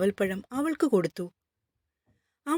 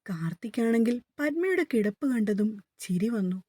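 usual speaking voice

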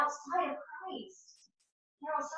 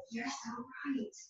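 retold teary near-whisper; tearful whisper; 13 dB quieter, slower than usual. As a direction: breathe it out as if through tears. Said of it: "And she started crying".

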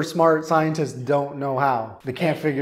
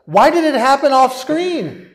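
very high, loud exasperated outburst; angry disbelief; 9 semitones higher, 6 dB louder than usual. Real, not acted. Reviewer ranting about an off-screen plot, angrily incredulous. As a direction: wail in outrage, half laughing. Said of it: "this story made me angry, as you can tell".

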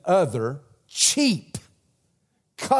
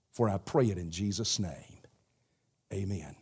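soft, hushed pastoral prayer; quiet prayer; 4 semitones lower, 5 dB quieter than usual. Acted. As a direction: pray gently over the congregation, much softer.

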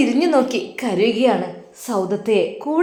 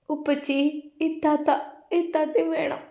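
put-on higher, much softer child's plea; small girl pleading softly; pleading little girl; 6 semitones higher, 7 dB quieter than usual. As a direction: beg daddy like a tearful little girl. Child begs father to bring aunt.

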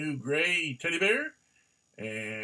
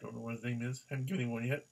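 ordinary talk

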